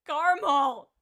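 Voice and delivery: excited playful call; loud call. Real, not acted. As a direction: call the name out loud and high. Calling out a friend's name.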